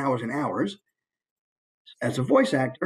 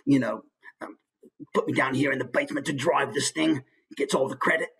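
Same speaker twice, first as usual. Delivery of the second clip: grumbling disgruntled boat driver; muttered character complaint about the job. Shown as a demonstration. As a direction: grumble along like an unhappy worker. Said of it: "So maybe he's just grumbling".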